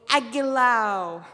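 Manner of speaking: slow, loud incantation; spell cast. Acted. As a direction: call out the fire spell. Demon casting a fire spell.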